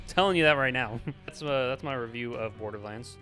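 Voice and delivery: usual speaking voice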